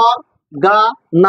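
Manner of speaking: অর্গানাইজেশন slowly, with gaps between syllables. Shown as a demonstration. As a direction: break অর্গানাইজেশন into its separate syllables. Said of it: "এইভাবে syllable ভাঙ্গি ভাঙ্গি করবা".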